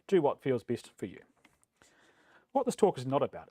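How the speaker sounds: ordinary talk, nothing marked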